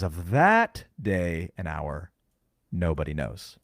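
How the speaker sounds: "that" stretched long and swooping up; stress on THAT. Shown as a demonstration. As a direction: lean slowly on "that", then drop low for the rest. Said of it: "I have to put emphasis on the word".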